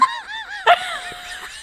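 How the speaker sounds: breathless wheezy laughter; wheezing laugh. Real, not acted. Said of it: "It got my wheeze like it was in the back of my throat".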